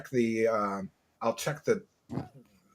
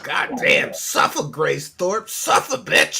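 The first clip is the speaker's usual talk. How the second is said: raspy, loud taunt, rising high at the end; gloating mock jeer; 5 semitones higher, 6 dB louder, slower than usual. Host cheering guest's takedown of a rival, raspy jeer. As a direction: crow it like a ringside heckler.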